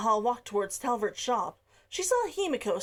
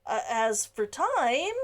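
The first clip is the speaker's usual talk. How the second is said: hesitant, halting. Acted. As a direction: stall nervously before the bad news. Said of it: "he paused".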